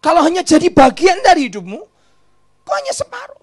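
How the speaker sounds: loud, high rebuke; full-force pulpit cry; preaching shout. Acted. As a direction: thunder it at them.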